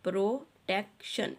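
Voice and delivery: Protection said slowly, split as pro, tek, shun; syllable by syllable. Shown as a demonstration. Teacher showing children how the word divides, syllable by syllable. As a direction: break protection into its syllables with small gaps. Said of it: "इस तरह से आपने इसके syllables लिखे हैं".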